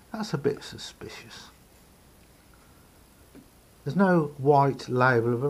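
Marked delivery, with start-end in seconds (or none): 0.1-1.5 whispered aside, almost to himself; a hushed, wary whisper to the viewer; suspicious whisper. Real, not acted. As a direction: breathe it out like a secret suspicion. Suspicious aside while inspecting radio.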